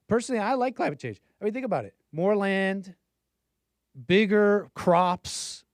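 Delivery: high, playful mock enthusiasm, slowing on bigger crops; mock cheerful. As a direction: sell the joke with tongue in cheek. Joking provocation about climate.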